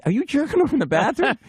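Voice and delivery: high, incredulous question; imitated wife. Wife on the phone to husband, high imitated disbelief. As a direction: pitch it way up as the puzzled wife. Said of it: "She goes".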